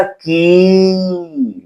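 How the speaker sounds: "कि" with a long, sustained i; extra-long vowel. Shown as a demonstration. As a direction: hold the vowel of "कि" far past normal length. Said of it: "इसको ज्यादा देर तक पढ़ना है". ("कि" को बहुत देर तक खींचकर)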